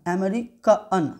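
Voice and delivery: अमेरिकन slowly split as "ameri-ka-an"; ending broken down. Shown as a demonstration. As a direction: draw out अमेरिकन to show "ka... an". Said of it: "अ आ रहा है ना". (अमेरिकन धीरे, "अमेरि-क-अन" तोड़कर)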